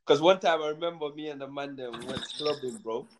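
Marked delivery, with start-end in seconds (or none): none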